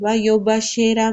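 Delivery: ویبشر said "wa yo-bashera", with o and e; ویبشر with open "yo" and "she" vowels; mistaken reading. Shown as a demonstration. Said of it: "کوئی نہ پڑھے".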